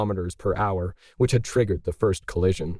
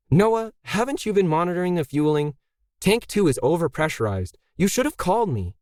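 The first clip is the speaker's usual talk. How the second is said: higher voice, a firm, motherly scold; Emily, brisk facility owner; 8 semitones higher than usual. A narrator's reading. Emily scolding Zoe and Noah before launch, higher and firm. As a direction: take charge and hurry them along.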